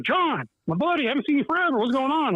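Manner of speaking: high, overly chummy greeting; butter him up, sugary; imitating a fake-friendly boss; fake friendly promoter. Voicing Wild Bill's fake friendliness.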